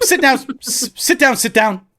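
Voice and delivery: high mock dog commands; playful dog-training voice. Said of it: "Like, this kind of thing".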